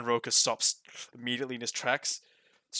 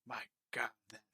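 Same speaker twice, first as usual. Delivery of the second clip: wistful shopkeeper; 3 semitones lower, 6 dB quieter than usual. Acted. Boss reminisces quietly.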